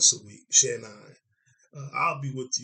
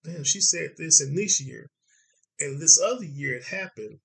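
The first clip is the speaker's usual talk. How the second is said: amazed realization; 6 semitones higher, 5 dB louder than usual. Retold or imitated. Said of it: "I'm like".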